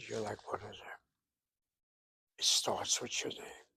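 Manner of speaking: a whispered aside to the class. Real, not acted. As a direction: breathe it out as a secret. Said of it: "whispered aside".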